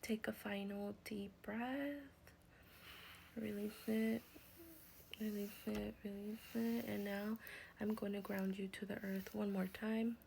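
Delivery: soft, unhurried breathing cue voice; guided meditation close. Acted. Healer grounds and seals the healing for viewers, hushed meditation voice. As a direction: breathe it out with them, gently.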